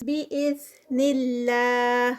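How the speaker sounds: slow, drawn-out tajweed recitation; Quranic recitation. Shown as a demonstration. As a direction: chant it slowly, stretching each sound. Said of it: "اللہ کا نام light ادا ہوگا". (آہستہ کھینچ کر تلاوت)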